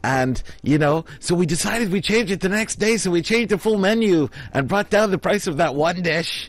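high-pitched mock cover story; imitated flustered excuse. Scammer recruiting a bystander as witness, high imitated excuse.

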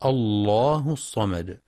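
الله begun afresh with a full dark l; heavy lam. Shown as a demonstration. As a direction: slowly recite الله with a thick Allaah. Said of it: "وتفخم إذا ابتدأ بها".

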